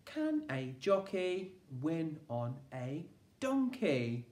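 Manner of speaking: put-on slow, even, every word spaced for children to join; slow choral reading. Shown as a demonstration. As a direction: say it together slowly. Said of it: "You say it with me".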